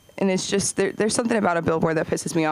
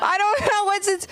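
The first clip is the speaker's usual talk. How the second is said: baffled; 13 semitones higher than usual. Retold or imitated. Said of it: "And I was like".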